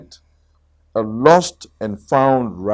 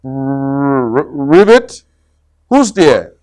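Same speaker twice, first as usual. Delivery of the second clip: frightened stammer; 5 dB louder than usual. Acted. Scared Rabbit stammering.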